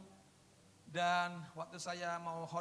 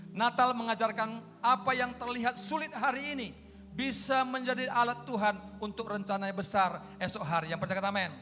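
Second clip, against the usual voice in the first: loud, raised declamatory sermon; forceful preaching; 5 semitones higher, 7 dB louder than usual. Real, not acted. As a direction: proclaim the lesson and call for amen.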